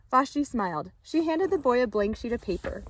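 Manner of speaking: usual speaking voice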